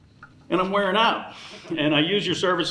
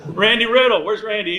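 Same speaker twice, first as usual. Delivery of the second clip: calling out; 7 semitones higher, 5 dB louder than usual. Real, not acted. Calling to a guest in back.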